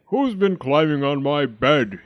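very loud big bear voice; roaring Daddy Bear. A narrator's reading. Daddy Bear finds his bed disturbed, roaring. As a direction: roar the question out. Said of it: "he roared".

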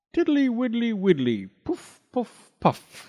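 high toad voice, puffing out the words; high puffing singsong; toad blowing seeds. Acted. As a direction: blow the thistledown while you chant. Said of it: "said Mister Jackson".

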